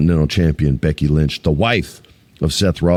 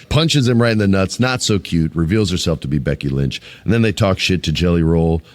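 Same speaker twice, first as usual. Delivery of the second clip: ordinary talk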